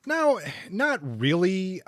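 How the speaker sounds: ordinary delivery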